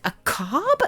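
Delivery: can't believe what the cat took; disbelief. Retold or imitated. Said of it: "and I'm just like".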